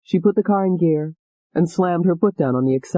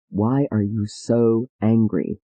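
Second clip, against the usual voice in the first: low, slow, measured question; lower, slow doctor's voice; calm doctor; 6 semitones lower, slower than usual. Acted. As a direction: ask it slowly and gently.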